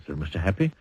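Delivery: usual speaking voice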